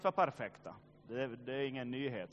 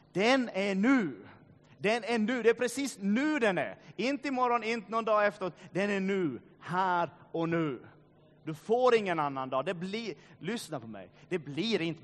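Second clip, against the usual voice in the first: loud, pressing preaching, hammering "nu"; urgent preaching; 6 semitones higher, 7 dB louder than usual. Acted. Pastor urging listeners to act now, loud preaching. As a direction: drive it home that the day is now.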